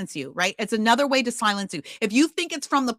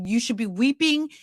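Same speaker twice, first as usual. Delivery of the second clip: normal talking voice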